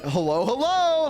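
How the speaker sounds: high, drawn-out hallooing; in-game yell, imitated. Human barging into the elven area, singsong yell. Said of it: "in slash yell, which is a big radius".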